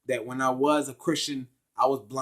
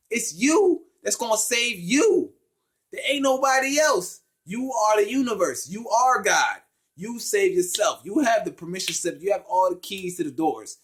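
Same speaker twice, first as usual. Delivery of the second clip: preachy revelation; 6 semitones higher, 4 dB louder than usual. Retold or imitated. Preaching his revelation to himself.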